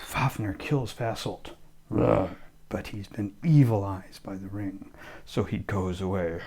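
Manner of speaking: usual speaking voice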